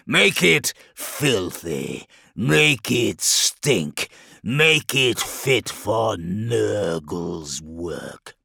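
very slow, drawn-out demon command; ponderous demon lord giving orders. A narrator's reading. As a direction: relish each order, dragging the words. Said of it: "he commanded".

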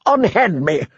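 high, pleading prisoner voice; desperate plea. A narrator's reading. Prisoner begging Sir Axel, high and desperate. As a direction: beg to be let go. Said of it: "the prisoner asked desperately".

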